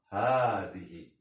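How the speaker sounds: هذه slowly, with "haa" stretched out; pronunciation demo. Shown as a demonstration. Teacher shows how the written word sounds, هذه with long "haa". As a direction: draw out the first vowel of هذه. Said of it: "تنطق".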